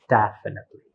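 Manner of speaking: "Definitely" with a high first syllable, the rest falling away; stress on first syllable. Shown as a demonstration. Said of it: "you have the high tones on this".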